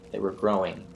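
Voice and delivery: plain reading voice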